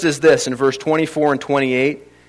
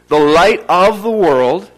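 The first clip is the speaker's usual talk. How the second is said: "light of the world" slow, higher and loud; emphatic realization; 4 semitones higher, 6 dB louder, slower than usual. Shown as a demonstration. Preacher waking hardened listeners, "light of the world" slowly stressed. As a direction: punch out "light of the world" word by word. Corrects desensitized hearing with emphasis.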